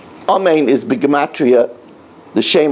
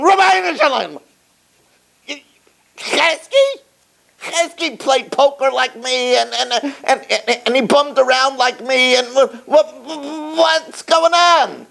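indignant complaint; 10 semitones higher than usual. Acted. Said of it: "Shmuel comes to Rabbeinu Shalom".

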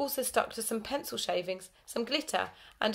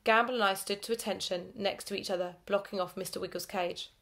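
ordinary delivery, nothing marked

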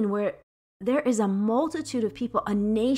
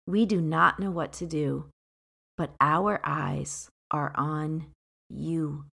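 solemn verse reading; scripture reading; 4 semitones lower than usual. Acted. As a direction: read the favorite verse with weight. Here comes my favorite line.

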